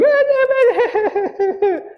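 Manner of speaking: high, put-on wail of a kid faking hurt; a very high mock howl to get sympathy; fake crying wail. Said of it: "I could just start going".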